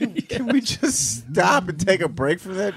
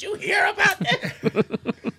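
laughing; 13 semitones higher, slower than usual. Real, not acted. Laughing about drunk texting.

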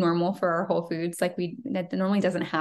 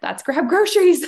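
cheerful decision; 9 semitones higher, 9 dB louder than usual. Retold or imitated. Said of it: "And I'm like".

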